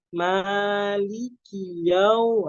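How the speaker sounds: "Maliki yau-" chanted slowly, clear full i vowels; full kasrah. Shown as a demonstration. Teacher models perfected kasrah, slow chanted "Maliki yau-". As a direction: draw out each i in "Maliki". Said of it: "disempurnakan kasrohnya".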